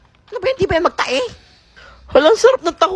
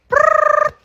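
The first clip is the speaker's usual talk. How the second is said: shrill mouth whistle trill; sharp whistle sound; whistle blast; 12 semitones higher, 4 dB louder than usual. Acted. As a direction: blow it like a recess whistle. Teacher blows whistle for recess.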